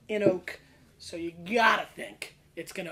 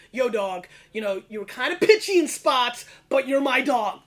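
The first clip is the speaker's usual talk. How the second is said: Randy; 3 semitones higher, 9 dB louder than usual. Acted. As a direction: belt out a hip, chummy judge's critique. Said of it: "Let me just do Randy".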